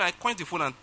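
ordinary talk, nothing marked

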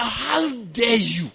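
slow, drawn-out retold outrage; livid rage. Pastor replaying his angry curse on the thief, slow outraged. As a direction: stretch each word with remembered fury. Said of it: "I was so livid with rage".

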